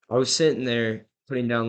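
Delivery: normal talking voice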